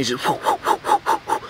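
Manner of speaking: high, airy whoosh of a runner flying past. Retold or imitated. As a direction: mimic someone zooming by. Another runner blazing past.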